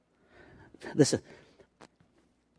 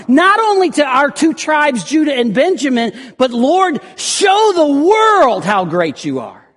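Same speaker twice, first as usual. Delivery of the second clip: high, loud, shouted prayer for God's glory; fervent prayer; 10 semitones higher, 6 dB louder than usual. Acted. Hezekiah praying for the world to know God, shouted. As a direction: proclaim God's greatness to the world. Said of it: "but he's saying".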